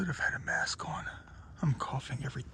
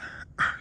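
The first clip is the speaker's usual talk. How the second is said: throat clearing; 5 dB louder than usual. Real, not acted. Dusty attic, throat clearing.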